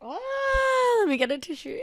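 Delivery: holding back tears, reaching for a tissue; tearful. Real, not acted. Podcaster crying to listeners as she signs off, tearful. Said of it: "I'm only now bawling my eyes out".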